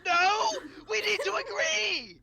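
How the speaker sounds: loud, high, half-laughing plea; laughing desperate shout. Real, not acted. Pleading with the party to settle the safe word, laughing shout. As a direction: beg them to just agree.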